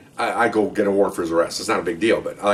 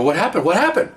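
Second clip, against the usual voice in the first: rising, higher urgent demand; alarmed question; 9 semitones higher than usual. Retold or imitated. Cop demanding answers from his supervisors, rising alarm.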